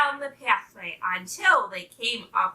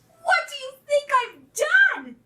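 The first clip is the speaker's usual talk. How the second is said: high-pitched, boastful little pup; proud pup in a high, excited voice; excited pup's cry; 4 dB louder than usual. A narrator's reading. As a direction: cry it out high, bursting with news. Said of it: "he cried".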